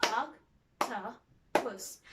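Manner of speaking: "Octopus" slow and soft, in three separate beats; split into syllables. Shown as a demonstration. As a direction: gently break "Octopus" into oc, to, pus. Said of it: "And then split the word".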